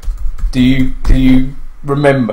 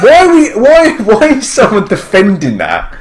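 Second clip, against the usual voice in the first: high, louder burst of disbelief; outraged; 6 semitones higher, 4 dB louder than usual. Real, not acted. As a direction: let the outrage break out. Outraged at article defending mother.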